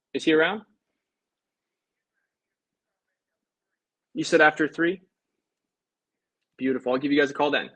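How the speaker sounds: friendly cold-call opener on the phone; upbeat phone greeting. Real, not acted.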